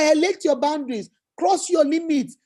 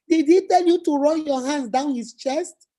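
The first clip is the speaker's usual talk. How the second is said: normal talking voice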